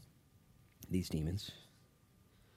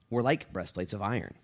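usual speaking voice

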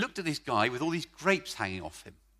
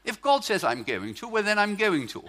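neat Oxford English, "going to" said in full; proper Oxford English, every "going to" complete; mock-refined Oxford English, laughing a little; Oxford version; 6 semitones higher, 5 dB louder than usual. Shown as a demonstration. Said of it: "that's the Oxford version".

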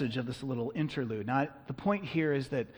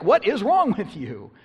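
loud, high indignant outburst; exasperated scolding at raised pitch and volume; really angry; 7 semitones higher, 10 dB louder than usual. Acted. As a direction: demand it in angry disbelief. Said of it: "And then one part really angry".

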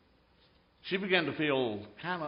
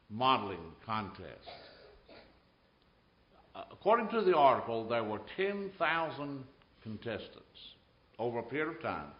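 usual speaking voice

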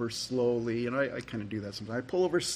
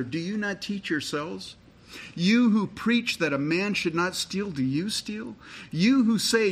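preaching scripture; 4 semitones higher than usual. Acted. Reads Romans with preaching force.

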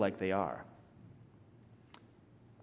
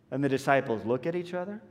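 normal talking voice

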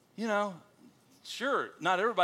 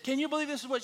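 high-pitched mock outrage; retold indignation; 11 semitones higher, faster than usual. Pastor quoting his wife home from shopping, mock indignant. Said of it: "she came home mad at this lady".

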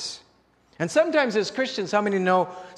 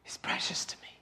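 hushed whisper; 12 dB quieter, faster than usual. Real, not acted. Preacher to congregation about Christ's blood, whispered. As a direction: breathe the words almost without voice.

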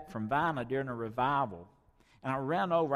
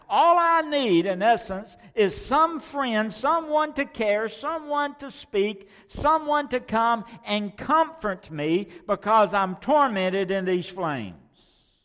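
much higher and loud, slow desperate plea; anguished cry; 8 semitones higher, 8 dB louder than usual. Acted. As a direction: wail for someone to comfort you. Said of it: "He cried".

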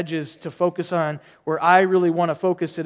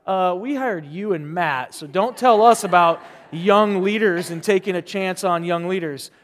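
mock protest, a little higher and louder; imagined congregation objecting; 4 semitones higher, 6 dB louder than usual. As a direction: voice the congregation's pushback, playfully. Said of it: "you're probably all looking like".

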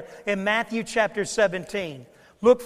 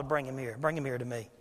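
low and much softer, a calm summons; quiet command; 8 semitones lower, 10 dB quieter than usual. Retold or imitated. Jesus calling the father to bring his son, low and soft. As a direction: call the boy over gently but firmly. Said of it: "Jesus says to the father".

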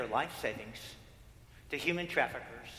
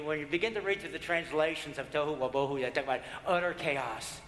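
ordinary talk

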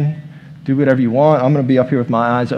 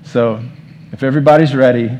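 ordinary delivery

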